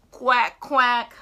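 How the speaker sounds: loud quacking; duck quack. Retold or imitated. Reviewing the sounds, loud duck quacks. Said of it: "let's see if I got the sounds right".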